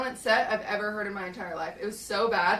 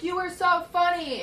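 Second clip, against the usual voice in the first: high, loud call over a car; yelled compliment; 9 semitones higher, 5 dB louder than usual. Retold or imitated. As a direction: imitate someone gushingly calling out from a distance. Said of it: "yells over the car".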